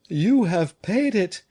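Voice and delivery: high, slow, stricken cry; dismayed cry. A narrator's reading. Madame Fouquet cries out in dismay to her husband. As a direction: gasp it out in horror. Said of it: "cried Madame Fouquet".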